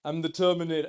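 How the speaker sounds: loud, badly faked action-robot voice; bad Terminator. Giving a movie quote example, bad Terminator impression. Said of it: "It's a really bad impression".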